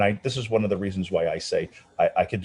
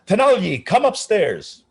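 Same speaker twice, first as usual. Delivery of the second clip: maestro's greeting; 13 semitones higher, 6 dB louder, slower than usual. Retold or imitated.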